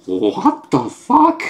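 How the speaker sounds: slow, high rising bewilderment; drawn-out disbelief. Acted. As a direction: stretch it out in shocked confusion. Stunned reaction to comic panel.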